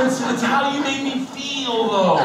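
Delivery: high mocking imitation of his wife, laughing. Pastor mimics his wife in their spat, high and mocking. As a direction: parody her line in a high voice.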